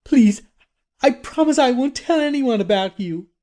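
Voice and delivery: put-on very high, pleading girl's voice. A narrator's reading. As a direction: beg desperately, pitched right up. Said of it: "Jenna pleaded".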